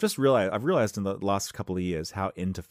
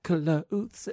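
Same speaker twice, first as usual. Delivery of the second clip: puzzled question; 10 semitones higher, 3 dB louder than usual. Acted. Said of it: "she's like".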